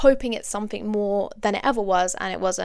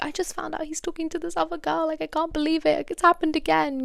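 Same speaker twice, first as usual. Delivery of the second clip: higher, half-sobbing mock upset voice; imitating her crying self; 7 semitones higher, 3 dB quieter than usual. Calling her best friend in tears, mock crying voice. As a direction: play back your tearful phone call, pitched up. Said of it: "I'd called her crying".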